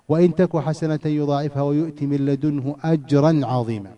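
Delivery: measured recitation of the verse; reciting the verse in class; Quran recitation. Acted. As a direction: chant the verse steadily like a reciter.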